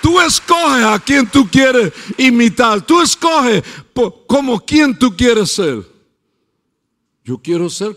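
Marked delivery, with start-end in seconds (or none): 0.0-5.9 loud, high, driving sermon voice; fiery preaching. Acted. Pastor preaching to the congregation, building and loud.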